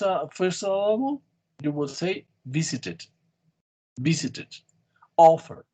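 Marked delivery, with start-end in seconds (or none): 2.5-4.5 "visited" with VI stressed, the rest quick; stress on first syllable. Shown as a demonstration. Teacher explains first syllable stress, "visited" stressed on VI. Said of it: "stress in the first". 5.2-5.7 "offer" loud on OF; stress on first syllable. Shown as a demonstration. Teacher gives a first-syllable example, "offer" stressed on OF. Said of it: "stress in the first".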